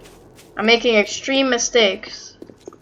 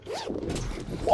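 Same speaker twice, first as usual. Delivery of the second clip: sharp breathy gasp of fright; gasp, caught off guard; startled gasp; 15 dB quieter than usual. Real, not acted. Zombie jump scare.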